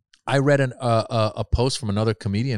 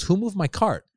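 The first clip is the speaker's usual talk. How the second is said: higher, indignant shopper; imitating rude lady; 10 semitones higher than usual. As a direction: demand who moved the cart.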